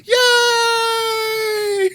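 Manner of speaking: high, loud cheering crowd voice; squealing yay yay; mock cheering. Voicing crowd cheering in game.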